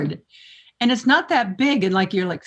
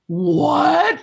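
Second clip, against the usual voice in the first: surprised what; 6 semitones higher than usual. Retold or imitated. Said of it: "It was like".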